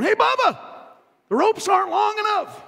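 high, loud holler to a friend; hammed-up high-pitched yell; calling out. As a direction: shout it across the rooftop. Man on roof calls to Bubba.

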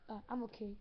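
ordinary talk

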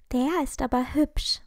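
ordinary delivery

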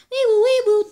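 loud, high wee-woo siren; siren noise. Acted. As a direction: wail it like an ambulance. Ambulance siren in the story.

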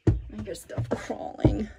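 hushed, breathless whisper in the dark. Real, not acted. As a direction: whisper so nothing hears you. Creeping through a buzzing attic.

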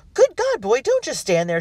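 high, loud panicked command; screamed order. A narrator's reading. Guest orders the butler to move, high screamed command. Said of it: "she screamed at the butler".

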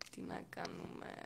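whispered resignation. Real, not acted. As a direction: breathe it out in a whisper.

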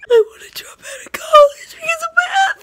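loud, high sobbing wail; crying wail. Real, not acted. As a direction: wail it out through tears. Crying wail after math meltdown.

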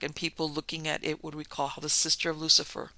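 usual speaking voice

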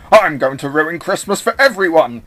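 loud villainous boast; gloating at full volume; mocking villain. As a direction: declare the evil plan loudly. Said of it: "Eggman mocked him".

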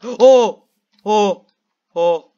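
high, loud gasping cries; terrified gasps. Real, not acted. Gamer reacting to the jump scare with terrified gasping oh's. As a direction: gasp and cry out in fright.

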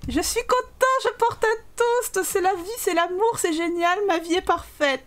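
high, hammed-up delight easing down; gushing happiness. Happy character toasting love and life, high gushing pretend joy. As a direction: gush a mock wedding toast.

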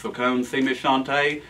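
ordinary delivery

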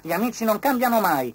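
a loud little grasshopper; grasshopper's voice. A narrator's reading. Grasshopper insisting friends never change, high voice. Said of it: "pensò Cavalletta".

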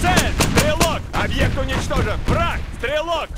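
shouted callout. Acted. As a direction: call the target out hard. Combat callouts in game.

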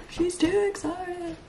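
high, slow, soft singsong; high self-mockery. Vlogger mocking herself in third person, high singsong. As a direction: tease in a small high voice, drawing it out.

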